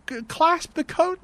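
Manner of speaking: high, slow, insistent order; heated exasperation. Real, not acted. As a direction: spell it out, fed up. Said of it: "I'm getting a little hot already".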